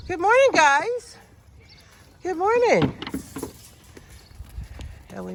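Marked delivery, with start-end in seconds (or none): 0.1-2.9 loud, high greeting; calling out. Real, not acted. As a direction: call out cheerfully to the animals. Calling out to animals.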